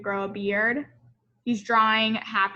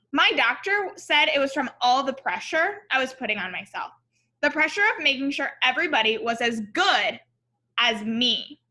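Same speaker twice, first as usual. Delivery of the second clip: story narration; 4 dB louder than usual. A narrator's reading. Reads picture book aloud.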